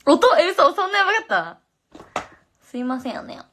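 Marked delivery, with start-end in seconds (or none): 0.0-1.6 high, loud startled reaction; real surprise at the noise; surprised. Real, not acted. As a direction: caught off guard, voice jumps up. Told her mic made noise.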